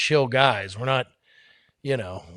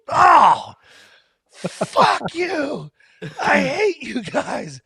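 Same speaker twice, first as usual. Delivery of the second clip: loud, high cry then drawn-out whine; high-pitched, hammed-up tantrum; mock upset outburst; 11 semitones higher, 5 dB louder, much slower than usual. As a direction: imitate a sore loser yelling as he storms off. Retold wrestler storming off hurt.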